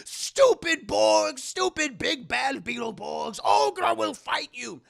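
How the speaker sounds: put-on very high, slow, roaring old-crone voice, far from own; Ogra's furious yell. Old Ogra yells at the Beetleborgs raiding her observatory, very high slow roaring shout. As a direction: bellow like an old hermit defending her home.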